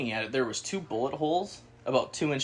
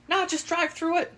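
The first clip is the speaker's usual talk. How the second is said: high, put-on voice for the old lady; old lady's voice. Landowner lady waving them through the field, high put-on voice. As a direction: play the landowner lady, easygoing. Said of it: "She's like".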